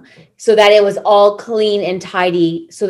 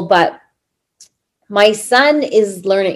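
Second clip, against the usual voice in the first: ordinary delivery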